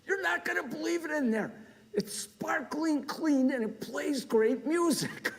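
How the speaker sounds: high, excited imitation of his wife; wife's voice. Husband imitating his wife raving about the toilet, high excited voice. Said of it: "and she goes".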